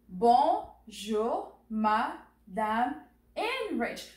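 "Bonjour, Madame" slowly, with gaps between syllables. Shown as a demonstration. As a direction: break "Bonjour, Madame" apart for a learner to repeat. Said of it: "So repeat after me".

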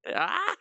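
short high yelp; real sudden high cry; startled yelp. Real, not acted. Streamer checking if the avatar is done, startled yelp.

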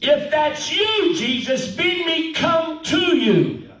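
high, slow, drawn-out calling; Peter. Acted. Disciple calling to Jesus from the boat, high slow call. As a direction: call across the water, stretching the words. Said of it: "Peter looks out and he goes".

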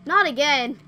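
exasperated shout. Real, not acted. Another jump scare.

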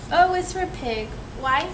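ordinary delivery

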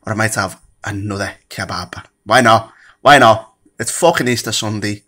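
normal talking voice

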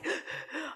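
imitating a sharp panicked gasp; retold gasp. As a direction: act out his gasp of shock. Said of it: "I'm hyperventilating".

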